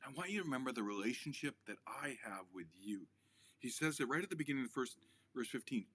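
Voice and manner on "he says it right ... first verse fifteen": ordinary delivery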